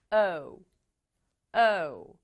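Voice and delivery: O said like the letter's name, "oh-oo"; long O sound. Shown as a demonstration. Teacher showing pupils the vowel in cone, O as a full "oh-oo". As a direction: say O as a gliding "oh-oo". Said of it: "O의 이름처럼 O 소리를 내야 돼요".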